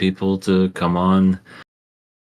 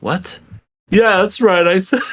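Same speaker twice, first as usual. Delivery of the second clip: sleazy salesman; 9 semitones higher, 5 dB louder, slower than usual. Pretend. Hammed-up pitch to a guest.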